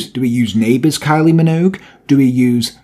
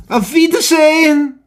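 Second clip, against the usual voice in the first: higher, hammed-up German goodbye; mock German farewell; 11 semitones higher than usual. Signing off the podcast to listeners, in a higher, hammed-up German farewell. Sign-off after German accent jokes.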